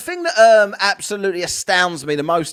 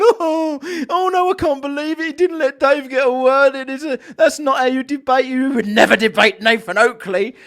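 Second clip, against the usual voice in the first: higher, whiny pearl-clutching fan voice; mocking the fans; 4 semitones higher than usual. Mocking fans who criticise the debate, in a whiny put-on voice. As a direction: mimic the shocked critics mockingly. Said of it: "and people are going".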